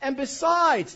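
high, loud whine on besides; hammed-up whine; whiny voice. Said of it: "this is the best".

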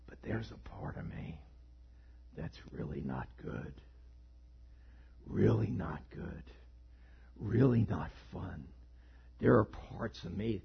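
low, whispered villain menace. Acted. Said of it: "I'm trying to do the villain voice".

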